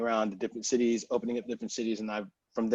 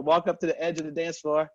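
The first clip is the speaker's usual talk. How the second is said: ordinary talk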